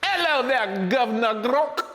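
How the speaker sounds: high, hammed-up Cockney greeting; mock British accent, playfully overdone. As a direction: put on a high-pitched comic British voice. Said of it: "pronounce it with a British accent".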